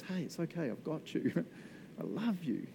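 soft, tender comforting voice; gentle reassurance. Acted. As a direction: comfort someone softly. God comforting someone in distress, acted.

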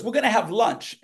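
put-on high Southern-accented announcement; Carl Killingsworth. Southern man announces lunch to the page, imitated Southern accent. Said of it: "that's how Carl talked".

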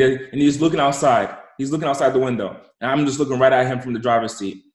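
ordinary talk

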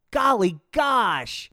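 drawn-out loud exclamation; big stoned golly gosh; loud slow golly gosh. Acted.

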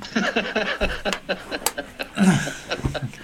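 long high run of chuckles; sustained, genuine high laugh; real laughter. Real, not acted. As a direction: laugh at your own story. Laughing at own remembered reaction.

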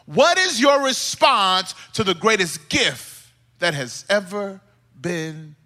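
fervent sermon with punched key words; impassioned preaching. Real, not acted. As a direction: preach it with full, rising conviction. Preaching at full force.

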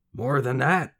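a touch of a sly salesman talking up the goods; cunning weaver. A narrator's reading. Weaver boasting to the young man about his daughter, lightly cunning. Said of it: "the cunning weaver said".

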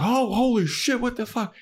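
high, shocked squeal; startled yelp. Retold or imitated. Retelling Xander waking to a caveman, high startled squeal. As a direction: jolt awake in a panic, way up high.

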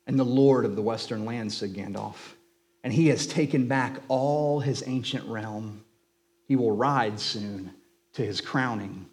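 a preacher lifting the page to life; story reading. A narrator's reading. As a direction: read it like a treasured bedtime story. Reads Tolkien excerpt aloud.

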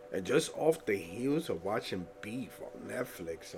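ordinary talk, nothing marked

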